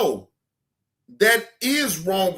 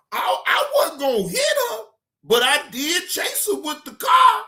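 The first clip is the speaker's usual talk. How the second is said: high-pitched, exasperated telling-off; scolding; 6 semitones higher than usual. Real, not acted. Streamer telling off the arrested woman, high scolding. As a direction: pitch it up and lay into her.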